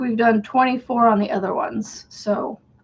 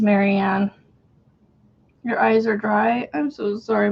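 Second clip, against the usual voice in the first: normal talking voice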